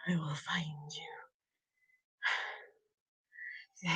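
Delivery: breathy playful threat; whisper. Pretend. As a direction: whisper it like a game.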